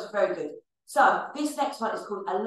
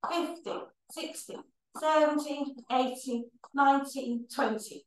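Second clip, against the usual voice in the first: breathless, voiceless counting; out of breath; 5 semitones higher, 5 dB quieter than usual. Real, not acted. Fitness teacher counting marches for the class, out of breath. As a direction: gasp the numbers out as the air runs out. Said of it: "I'm getting out of breath already".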